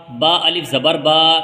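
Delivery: slow spelling with the last با held long; drawn-out vowel. Shown as a demonstration. Teacher showing both are equally long, final با drawn out. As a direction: stretch the final با on a level note. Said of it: "کھینچنے کے اعتبار سے دونوں برابر ہیں".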